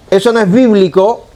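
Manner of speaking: brand them a heretic with the tone; accusing tone. Shown as a demonstration. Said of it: "vean el entono". (tono acusador)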